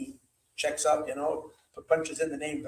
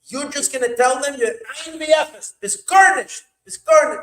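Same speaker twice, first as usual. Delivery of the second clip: rising to a loud high shout of garnished; demonstrated yell; 8 semitones higher, 9 dB louder than usual. Shown as a demonstration. Rebbe showing how to yell at them, loud building shout. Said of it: "That's how you're gonna have to yell at them".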